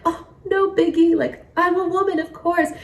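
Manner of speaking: high, breezy mocking voice; mock nonchalance. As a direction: shrug it off like it's nothing. Mocking dismissive attitude.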